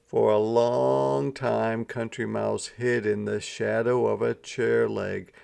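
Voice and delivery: usual speaking voice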